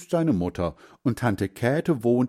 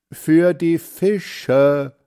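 put-on louder, very slow boy spelling it out; calling loudly; 7 semitones higher, 7 dB louder, much slower than usual. A narrator's reading. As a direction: call it slowly so grandpa hears. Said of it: "ruft Hans Frieder".

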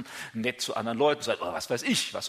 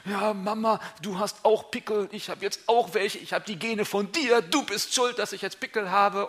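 higher, whiny accusing daughter; mock teenage complaint to her mother; young woman blaming mum; 7 semitones higher than usual. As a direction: blame mum for the spots, higher pitched. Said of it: "Vielleicht sagt dann die eine junge Frau".

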